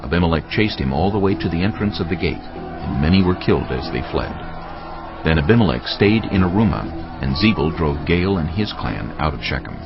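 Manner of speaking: ordinary talk, nothing marked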